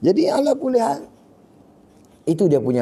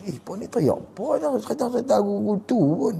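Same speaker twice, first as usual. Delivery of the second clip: higher, scornful mock voice of a companion; mocking sahabat; 7 semitones higher, 5 dB quieter than usual. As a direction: sneer at the weepers in a put-on voice. Sahabat mengejek mereka yang teriak. (suara mengejek seorang sahabat)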